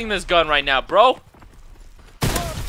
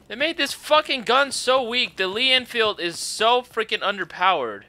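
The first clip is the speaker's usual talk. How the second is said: ordinary delivery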